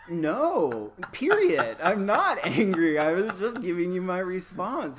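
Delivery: high, loud, exaggerated denial of being angry; mock indignant protest. Professor protesting to students who misread the email, mock indignant. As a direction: belt it out high in comic exasperation.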